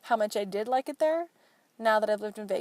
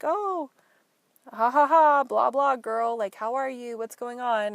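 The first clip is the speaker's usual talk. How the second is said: smarmy guy; 3 semitones higher, 6 dB louder, slower than usual. Retold or imitated. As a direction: ham up a fake chummy laugh. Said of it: "was just like".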